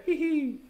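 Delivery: squeaky high tee-hee; sly high-pitched pirate tee-hee; high giggle. Shown as a demonstration. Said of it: "That's how it's written".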